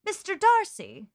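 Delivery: a touch of melodrama; mock dramatic reveal. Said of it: "she just says".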